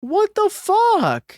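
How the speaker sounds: high, drawn-out shocked squeal; slow disbelieving shriek. Real, not acted. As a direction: stretch it out in disbelief. Reacting to game.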